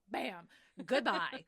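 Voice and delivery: loud, drawn-out "bam" and goodbye; slow, punchy hammed-up send-off; mock defiant farewell. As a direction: throw it out as a big exit line. Acted defiant exit after slamming whiskey.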